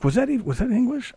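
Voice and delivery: high, soft confused foreigner voice; puzzled and lost; baffled listener. Retold or imitated. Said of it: "It's like".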